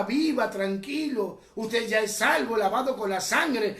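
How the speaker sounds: ordinary talk, nothing marked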